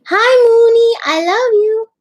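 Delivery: very high, loud cooing; high pet-greeting voice. Real, not acted. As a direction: coo loudly to a beloved pet, high.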